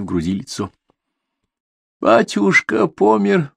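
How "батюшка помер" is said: high, slow mournful line, voiced rather than whispered; скорбный полушёпот в голос. A narrator's reading. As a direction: grieve as if the loss were fresh, drawn out and high. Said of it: "прошептала она с такой интонацией, словно скорбное событие". (скорбно, высоко и протяжно)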